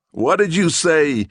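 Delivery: high, loud, slow challenge; truculent demand. Acted. Policeman truculently confronting the rambling Gerlich. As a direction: lean in and demand it. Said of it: "demanded the policeman truculently".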